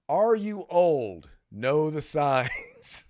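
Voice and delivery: slow, drawn-out title read; announced headline. Real, not acted. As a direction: stretch each word like a mock announcer. Reads out the list's title.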